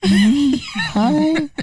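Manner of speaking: tiny high shy "Hi"; shy boy hello. Retold or imitated. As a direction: squeak it out like a shy kid. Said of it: "He's like".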